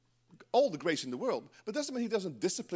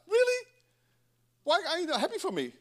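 mock indignant surprise; 6 semitones higher than usual. Voicing a hurt believer.

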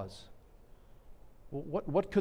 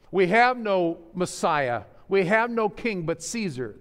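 crowd crying out; 5 semitones higher, 7 dB louder than usual. Retold or imitated. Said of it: "they cried".

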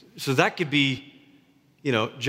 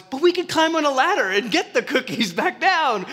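higher, excited Frog voice; eager Frog; 9 semitones higher than usual. Acted. Pastor reading Frog and Toad to congregation, eager higher Frog voice. Frog's voice in story.

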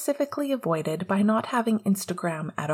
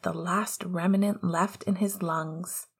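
ordinary delivery, nothing marked

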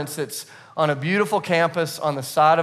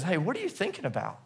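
soft in bed; 2 semitones higher, 10 dB quieter than usual. Retold or imitated. Wife speaking in bed at night.

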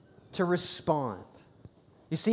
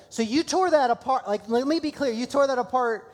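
quoting his wife; 6 semitones higher, 3 dB louder than usual. Retold or imitated. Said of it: "she goes".

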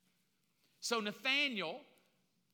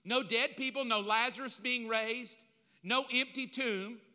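ordinary talk

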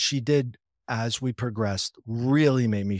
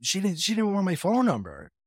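puzzled disbelief; 9 semitones higher than usual. Retold or imitated. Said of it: "And I'm like".

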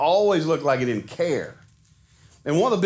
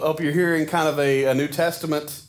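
ordinary talk

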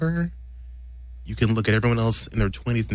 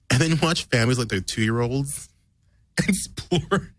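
ordinary talk

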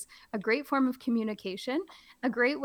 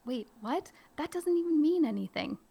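higher, puzzled double take; mock confusion; 6 semitones higher than usual. Teacher baffled by the book, mock confused. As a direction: act baffled by the page. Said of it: "I'm really trying to play it up".

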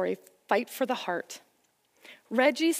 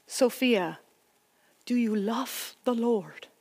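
put-on slow, thick Dutch accent; grandma speaking in thick Dutch accent; slower than usual. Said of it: "In her thick Dutch accent".

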